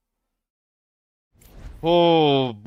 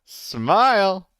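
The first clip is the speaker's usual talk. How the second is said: high, loud sing-song call; mock photographer cheer; cheery photographer; 8 semitones higher, 3 dB louder than usual.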